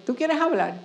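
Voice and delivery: retold challenge to a would-be attacker; confrontational challenge. Speaker squares up to the woman about to hit the girl, confrontational. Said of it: "y hice esto. Y le dije".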